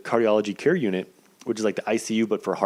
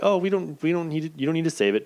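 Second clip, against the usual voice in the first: higher, casual nurse voice; higher-pitched nurse, relaxed; imitating the nurse; 7 semitones higher than usual. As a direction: mimic the nurse waving it off. Nurse voiced in retold story.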